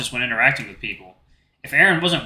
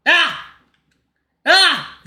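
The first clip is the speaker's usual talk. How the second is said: two high, loud panicked yelps; short scream of fright; startled yelp; 6 dB louder than usual. Acted. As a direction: yelp as if someone crept up on you. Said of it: "In a panic".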